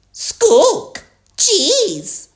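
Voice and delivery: high, short parrot squawks; Polly Parrot. A narrator's reading. Polly copies Grampy's order, high parrot voice. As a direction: squawk the word back like a bird. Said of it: "Polly Parrot copied".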